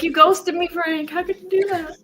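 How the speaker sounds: high, loud mock-hurt girlfriend; imitated girlfriend. Playing the ghosted girlfriend, high mock reproach. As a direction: accuse him in a high, put-on voice. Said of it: "She's like".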